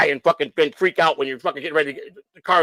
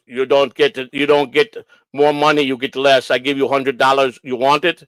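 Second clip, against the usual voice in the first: put-on Polish-accented haggling; 3 semitones lower than usual. Inspector offering the owner less money, Polish accent. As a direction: imitate a Polish mechanic making an offer. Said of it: "he sounded Polish to me".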